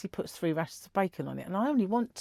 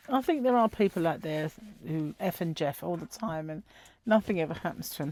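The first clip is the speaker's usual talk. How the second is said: ordinary talk, nothing marked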